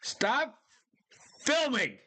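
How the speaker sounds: sudden high shout of alarm; yelling at the screen. Real, not acted. Shouting at bear-attack filmers to stop filming. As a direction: yell it as if they could hear you.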